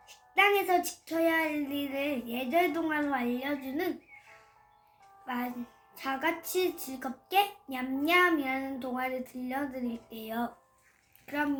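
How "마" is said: ordinary delivery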